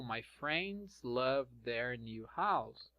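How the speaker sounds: each word slow and even; slow, careful model sentence; slow dictation pace. Shown as a demonstration. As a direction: read it out slowly for the class. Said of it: "model sentence".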